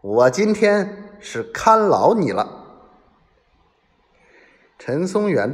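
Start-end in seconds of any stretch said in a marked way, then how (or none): 0.0-2.9 higher, slow, emphatic announcement; slow, determined raised voice; loud declaration. A narrator's reading. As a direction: declare it firmly and deliberately, voice raised. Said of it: "大声地说".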